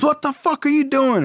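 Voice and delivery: very high-pitched acted yelp; startled outrage. Dad waking to Carl's caress, startled and outraged. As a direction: wake up shocked and indignant.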